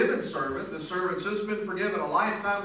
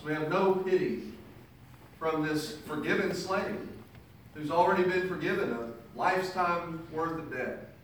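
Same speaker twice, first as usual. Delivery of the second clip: ordinary talk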